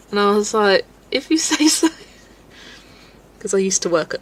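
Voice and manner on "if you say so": high, loud, laughing shrug; loud amused mock reply.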